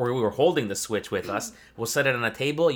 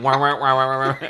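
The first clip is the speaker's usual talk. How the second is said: mock weird duck quack, laughing; imitating duck voice; 7 dB louder than usual. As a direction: copy her odd wah quacks. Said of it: "You're like".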